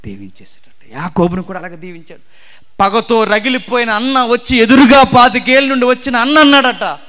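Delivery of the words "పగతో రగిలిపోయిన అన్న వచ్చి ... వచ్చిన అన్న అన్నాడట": loud, high impassioned sermon; fervent preaching. Acted. Preacher urging confession, loud impassioned preaching. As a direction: hammer the point at the congregation.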